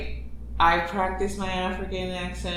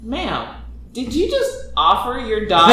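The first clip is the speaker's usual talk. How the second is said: high, amused incredulous confrontation; mock disbelief; 8 semitones higher, 8 dB louder than usual. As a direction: call out the mother, half laughing. Mock confronting a folk-tale mother.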